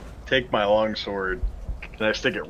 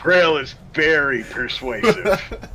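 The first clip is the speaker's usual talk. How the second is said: slow, higher, playful echo; drawn-out emphasis; 6 semitones higher, 4 dB louder, much slower than usual. Acted. Player backs up the threat to the bandit, drawn-out emphasis. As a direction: stretch it out with relish.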